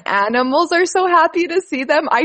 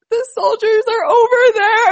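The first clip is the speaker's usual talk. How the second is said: much higher and louder, a hammed-up crying wail; retelling her own crying fit to her husband; mock sob; 10 semitones higher, 5 dB louder than usual. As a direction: blubber it out through tears. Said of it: "I was like".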